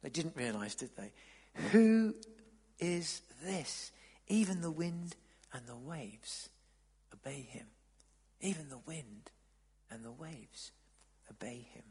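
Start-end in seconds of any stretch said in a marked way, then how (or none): 1.7-11.8 low, halting, fading almost to a whisper; hushed awe. A narrator's reading. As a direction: let it sink away in stunned wonder. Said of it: "They were terrified and asked each other".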